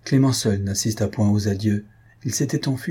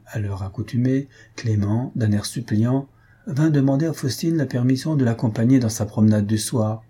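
usual speaking voice

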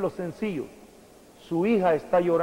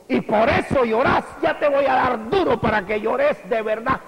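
louder, higher threat; mock angry parent; 6 semitones higher, 4 dB louder than usual. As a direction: bark the threat at the crying daughter. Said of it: "si usted llega a decirle". (padre enojado amenazando, fuerte y agudo)

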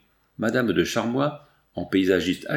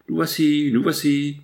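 mild raised-pitch call, said twice; narrator's restrained calling voice; 5 semitones higher, 3 dB louder than usual. A narrator's reading. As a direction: announce yourselves to the searchers, a little raised. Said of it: "criait-il".

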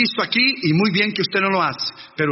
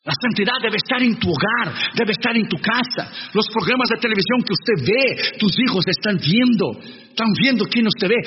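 ordinary delivery

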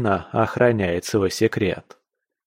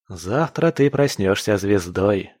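ordinary delivery, nothing marked

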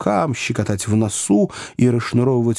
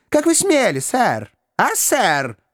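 indignant questioning; 11 semitones higher, slower than usual. A narrator's reading. Little man dictates an indignant question.

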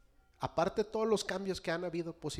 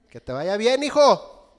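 higher, loud cheerful farewell; father's send-off; 7 semitones higher, 16 dB louder, slower than usual. Retold or imitated. Father calls goodbye to his son, high and loud. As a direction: sing out a bright goodbye. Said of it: "A los dos minutos".

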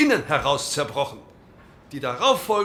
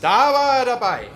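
loud, high interjection from the benches; heckling shout; 9 semitones higher, 6 dB louder than usual. Acted. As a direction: shout him down across the chamber. Heckler shouting in parliament debate.

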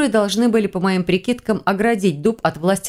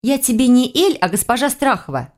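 haughty correction; 6 semitones higher than usual. Acted. Heroine demands proper title.

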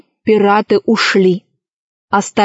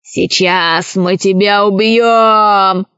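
slow, drawn-out pirate threat; one-eyed pirate threatening, without real volume; pirate's menacing cry; much slower than usual. A narrator's reading. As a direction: stretch every word out menacingly. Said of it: "закричал Одноглазый".

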